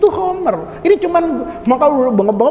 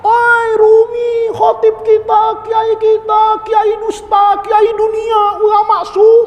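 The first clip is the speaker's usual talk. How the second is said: high, loud accusing yell; a disciple yelling insults at his teacher; 9 semitones higher, 3 dB louder, slower than usual. Acted. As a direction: shout it to the whole village. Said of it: "teriak dia".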